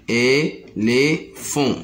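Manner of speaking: "éléphant" slowly split into é, lé, phant; slow sounding-out of "éléphant" for learners; syllable by syllable. Shown as a demonstration. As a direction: pause between the three syllables of "éléphant". Teacher repeats the split word.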